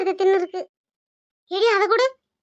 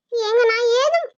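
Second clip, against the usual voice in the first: put-on higher, slow, hurt young wife; daughter-in-law denying she scolded mother-in-law; protesting daughter-in-law; 4 semitones higher than usual. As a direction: insist you never scolded her.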